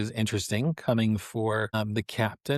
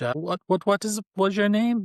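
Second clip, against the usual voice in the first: higher, hurried, stumbling captain; flustered captain; 8 semitones higher, faster than usual. Acted. Captain asking the fortune teller's name, flustered. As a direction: trip over the question in a raised voice.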